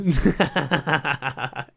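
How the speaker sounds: a burst of helpless laughter; real laugh. Real, not acted. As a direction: crack up at the picture. Laughing at a tweet.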